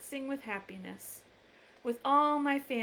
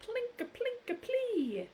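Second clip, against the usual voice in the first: high plinking voice; 12 semitones higher than usual. Acted. Piano sound in book.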